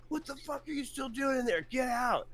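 high, strained mock cop yell. Cops yelling at him still inside, high strained yell. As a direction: play the frantic cop ordering him out.

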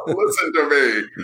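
slow booming robot voice; big robot voice. Pretend. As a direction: command it like a giant robot. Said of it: "I'm using my big robot voice now".

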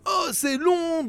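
mock complaint. Mimicking readers' complaint.

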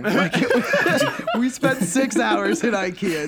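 high, slow, laughing disbelief. Real, not acted. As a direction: laugh while telling it.

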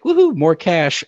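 put-on whoop of people getting free money; mock celebration. Mimics people getting the check.

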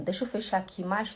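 ordinary talk, nothing marked

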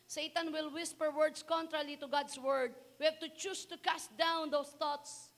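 loud, high preaching voice; fervent sermon delivery. Acted. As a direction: preach it out to the congregation. Preaching opening at full force.

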